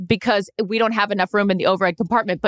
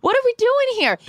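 exasperated rant; 13 semitones higher than usual. Real, not acted. Ranting about animals on planes.

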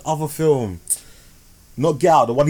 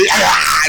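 shrieking laugh, louder; 4 semitones higher, 5 dB louder than usual. Real, not acted. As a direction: burst into a screaming laugh. Reacting to the gory scene.

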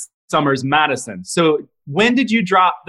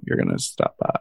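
a whispered aside, much lower; 6 dB quieter than usual. Real, not acted. Host teasing the guest about stopping by her booth, whispered. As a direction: breathe it as a low whisper. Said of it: "that whisper".

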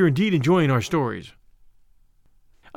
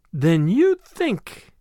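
very slow, halting question; slow, wondering, left hanging; dawning realisation; 2 semitones higher, much slower than usual. Acted. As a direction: draw it out while grasping the idea. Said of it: "Tuppence paused to grasp the supposition fully".

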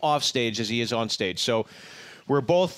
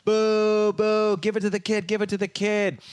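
higher mock boos and chants; crowd booing; 8 semitones higher than usual. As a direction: chant like an angry stadium crowd. Crowd booing the ball catcher.

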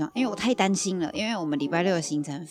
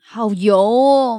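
drawn-out gush; 5 semitones higher, 12 dB louder, much slower than usual. Real, not acted. Gushing over oily chips.

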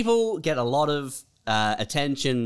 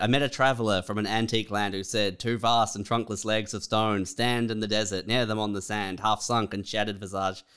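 poem recital; 5 semitones lower than usual. Acted.

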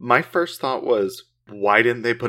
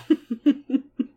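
high burst of laughter. Real, not acted. Laughs after demon bit.

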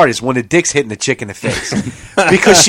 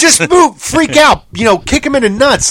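exasperated yell; 10 semitones higher than usual. Retold or imitated. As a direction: yell at her to do something. Yelling advice at the girl.

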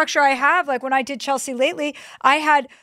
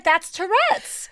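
higher, slow matter-of-fact claim; mock explanation; 7 semitones higher, much slower than usual. Little Chelsea explaining her swearing, slow higher deadpan. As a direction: explain it slowly like a proud child. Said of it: "I was like".

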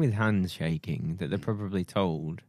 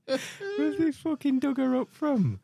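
mock old lady voice. Mocking the clip's old woman.

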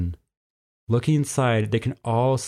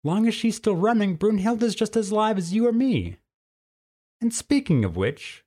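firm folksy cougar; 10 semitones higher than usual. A narrator's reading. Tilly insisting to the boys there are no spirits, firm. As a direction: set the boys straight, no nonsense.

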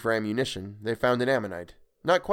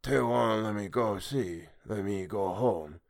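slow, lightly voiced creature with cold anger; 4 dB quieter than usual. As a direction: let the creature's resentment simmer slowly. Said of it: "cold anger in its voice".

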